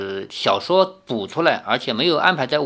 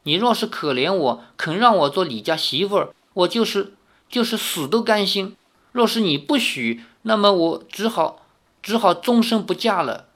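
put-on young girl's voice, higher, pleading through tears; tearful plea; 6 semitones higher than usual. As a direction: beg the aunt, close to crying. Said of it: "赵灵儿忍不住又落着泪". (少女含泪哀求)

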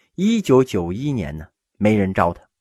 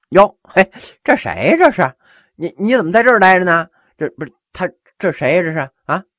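loud, higher, put-on astonishment; hammed-up fake surprise, louder and higher; mock surprise; 8 semitones higher, 6 dB louder, faster than usual. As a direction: feign that you only just noticed him. Host feigns not noticing guest.

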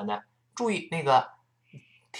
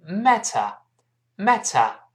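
British "matter" with crisp t and no r; British non-rhotic "matter"; British pronunciation; 5 semitones higher, 4 dB louder, much slower than usual. Shown as a demonstration. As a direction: say "matter" slowly as "mat-tuh", British style. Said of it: "英国人读出matter".

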